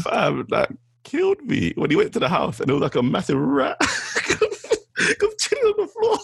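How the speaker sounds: high, slow, breaking into laughter; laughing through it. Real, not acted. Telling his friend about the rat clip, laughing hard. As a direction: gasp it out while cracking up.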